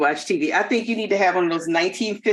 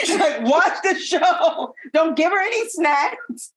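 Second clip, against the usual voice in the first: laughing mock; 9 semitones higher, slower than usual.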